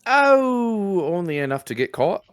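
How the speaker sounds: put-on high, loud cheeky boy voice; cheeky urchin. Urchin jokes back to the adventurer in a cheeky high voice. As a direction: toss the joke off like a street kid. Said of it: "this little boy says".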